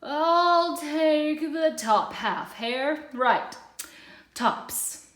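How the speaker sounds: slow, yawning bear drawl; sleepy Bear yawning. A narrator's reading. Bear picks the top half while yawning, slow and drowsy. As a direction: stretch it out through a yawn. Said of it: "Bear said with a yawn".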